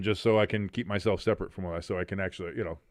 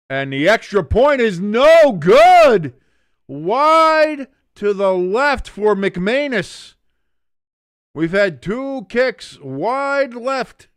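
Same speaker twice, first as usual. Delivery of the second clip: exasperated groan; 10 semitones higher, 12 dB louder, much slower than usual. Real, not acted. Disbelief at missed kicks.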